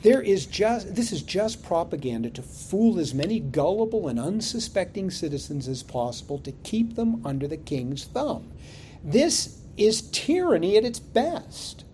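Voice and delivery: normal talking voice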